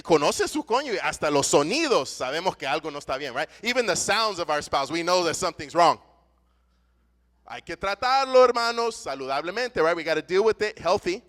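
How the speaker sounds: fiery pulpit delivery; impassioned preaching. Real, not acted. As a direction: preach it hard to the congregation. Preaching on unresolved conflict.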